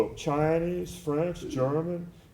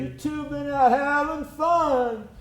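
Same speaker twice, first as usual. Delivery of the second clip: higher, slow, put-on teasing voice; Mama San voice; 12 semitones higher, 3 dB louder, slower than usual. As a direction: tease them slowly in a high lilting voice. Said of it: "she would say".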